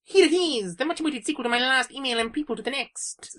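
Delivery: high, fast rushed email reading. Real, not acted. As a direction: rattle it off too fast. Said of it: "just lessen the tempo by about twenty percent".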